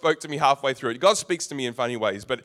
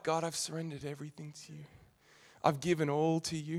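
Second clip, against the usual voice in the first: hushed prayer; 10 dB quieter than usual. Pretend. Imagined prayer.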